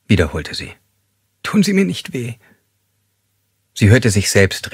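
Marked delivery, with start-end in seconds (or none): 1.4-2.3 high, frightened begging; helpless pleading. Acted. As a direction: beg him not to hurt her, voice up high. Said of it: "flehentlich und hilflos". (hoch und flehentlich, voller Angst)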